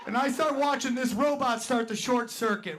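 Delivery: ordinary delivery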